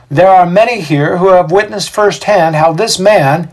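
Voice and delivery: high, loud public declaration; Xander, crowd orator. A narrator's reading. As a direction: proclaim loyalty to the magistrates. Voices Xander accusing.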